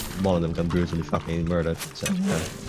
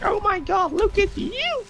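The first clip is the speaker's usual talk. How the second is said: much higher, overjoyed pet voice; excited dog voice; slower than usual. Pretend. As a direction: squeal it out like a dog thrilled to see you. Excited dog greeting visitor.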